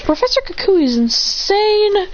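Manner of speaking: high, drawn-out exclamation; exclaiming. Acted. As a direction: stretch it out in high disbelief.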